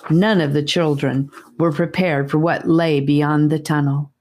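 usual speaking voice

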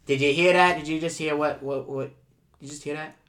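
ordinary talk

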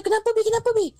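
alarmed husband. Retold or imitated. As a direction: rush to her, worried. Husband hears wife screaming.

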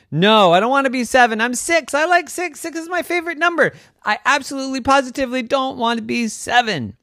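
put-on high, pleading child voice; insistent little girl. A narrator's reading. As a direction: insist like a stubborn six-year-old.

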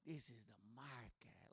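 low, quiet, offended insistence from the bike guy; offended local. Retold or imitated. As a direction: growl it back like he's insulted. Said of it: "he gets all offended at me".

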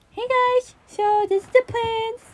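high, chirpy imitation; squeaky cheerful impression; high mock voice. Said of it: "Damon's just like".

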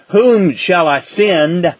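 slow, spaced-out scripture quotation; solemn quoted voice. Retold or imitated. Teacher quoting the voice Isaiah heard, slow and deliberate. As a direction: draw out each word, weighting the final "us". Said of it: "I heard a voice saying".

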